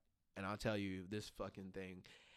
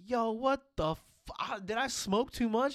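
higher, slow, baffled; higher, slow stoned disbelief; 10 semitones higher, 5 dB louder, slower than usual. Retold or imitated. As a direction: ask it like you're too high. High, hearing Japanese.

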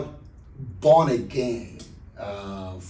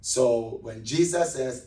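usual speaking voice

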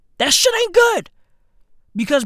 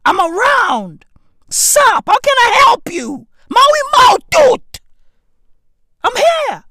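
hype persona shout; 11 semitones higher, 8 dB louder than usual. Acted. Shouting as his Maui Mau persona.

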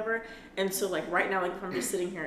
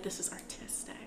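whisper; 8 dB quieter than usual. Retold or imitated. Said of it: "I was like".

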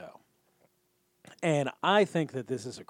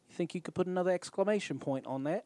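imitated New Zealand accent, pitched a bit higher; Rhys Darby; 4 semitones higher than usual. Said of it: "I was sold on his accent".

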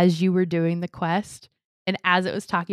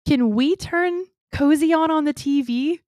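put-on higher, slow, pleading little-kid voice; mother imitating her son asking for the stream, higher and slower; imitating a small child; 8 semitones higher, 3 dB louder, slower than usual. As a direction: ask it like an eager toddler. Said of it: "he goes".

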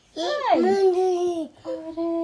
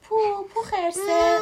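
high, drawn-out calling voice; story character calling, stretched and raised; louder call; 9 semitones higher, 5 dB louder, slower than usual. A narrator's reading. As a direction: call out to wake a sleeping friend. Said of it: "با صدای بلندتری داد زد".

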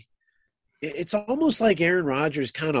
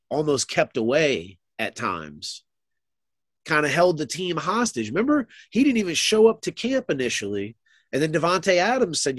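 normal talking voice

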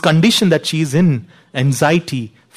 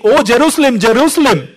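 hard tone; 6 semitones higher, 3 dB louder than usual. Retold or imitated. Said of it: "could be a hard tone to it".

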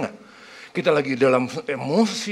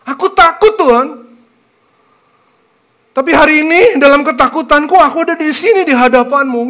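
louder, earnest confession to God; heartfelt prayer; 9 semitones higher, 8 dB louder than usual. Shown as a demonstration. Praying aloud to God, admitting fear, earnest and louder. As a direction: pour it out honestly to God.

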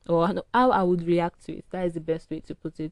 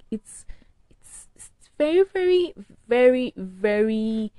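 exasperated build; 5 semitones higher, 6 dB louder, slower than usual. Real, not acted. Venting to viewers about stubborn arguers, exasperated rising emphasis. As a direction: pitch jumps much higher, then slows right down on each very.

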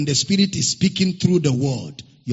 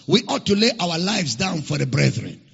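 ordinary talk, nothing marked

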